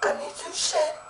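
lost-voice whisper; voiceless whisper. Retold or imitated. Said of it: "I was looking like".

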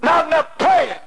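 rising, rhythmic revival preaching; fervent preaching. Acted. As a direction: preach it to the congregation with fire. Preaching at full force.

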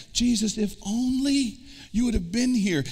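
high pleading cry of a grieving sister; Mary's lament. Retold or imitated. Preacher voicing Mary at the tomb, high pleading. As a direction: plead with Jesus, voice lifted high. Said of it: "she says the same thing".

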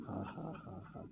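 soft, low faith laugh; quiet mock laughter at the enemy; a demonstration laugh, held back; demonstrated laugh. Shown as a demonstration. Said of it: "Just try to laugh".

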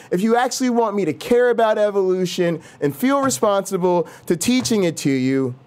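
usual speaking voice

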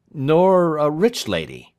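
high, loud, slow query; pressing question. A narrator's reading. Lawyer pressing client on his love, high and insistent.

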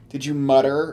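ordinary talk, nothing marked

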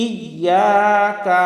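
slow "ইয়াকা", full I and long YA; sounded hamza. Shown as a demonstration. Teacher modelling the word, slow sounded hamza. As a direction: lean on the I, stretch YA, short KA in "ইয়াকা". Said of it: "হামজার উচ্চারণ করতে হবে".

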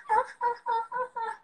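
high, soft cackle imitating everybody laughing; mock group laughter. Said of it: "I sound like a dying Regina".

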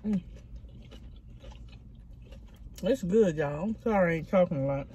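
talking with mouth full; muffled between bites; chewing food. Real, not acted. Seen 2: Picks food from bowl, chewing with closed mouth, fingers at lips.

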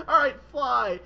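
high, loud cheery order to fly; mock command. Grandpa Drac throwing grandson off tower, loud cheer. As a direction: toss the line up brightly. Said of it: "He's like".

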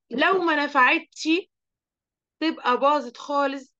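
ordinary talk, nothing marked

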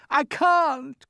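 high-pitched helpless cry; desperate cry. A narrator's reading. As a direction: cry out as a boy in despair. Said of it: "cried James".

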